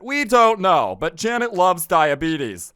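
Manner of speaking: high, loud, slow mock word-problem voice; put-on textbook voice, stretched and loud; math problem reader. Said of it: "read about in a math problem".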